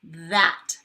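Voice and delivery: "That" with a loud voiced th at the start; loud demo of the voiced th in "That"; buzzing th. Shown as a demonstration. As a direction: buzz into "That". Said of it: "it's a buzzer".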